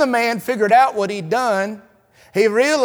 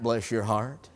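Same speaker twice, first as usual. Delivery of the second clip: syrupy pity; 10 semitones lower, 12 dB quieter than usual. Acted. Mock insult in Southern politeness.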